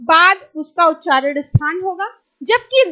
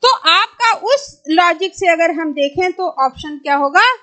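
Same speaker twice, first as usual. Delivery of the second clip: ordinary talk, nothing marked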